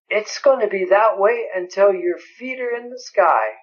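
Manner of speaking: ordinary talk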